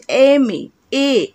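"এ" sounded separately as the vowel that opens "এম এ"; vowel sound shown. Shown as a demonstration. Teacher explaining why an precedes "এম এ", opening vowel sounded out. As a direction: say "এম এ", then pick out its first vowel "এ". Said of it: "এর উচ্চারণ আসছে তো".

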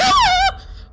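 high scream breaking into a laugh; shrieking laugh. Real, not acted. Laugh-scream after jump scare.

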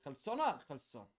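usual speaking voice

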